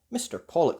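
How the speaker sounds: ordinary delivery, nothing marked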